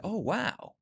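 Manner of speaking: surprised client. Retold or imitated. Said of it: "And then they go".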